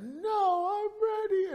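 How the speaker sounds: very high mock child's wail; squealed, drawn-up kid's voice; screaming young son. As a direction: imitate a small boy wailing in protest. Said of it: "started screaming even more".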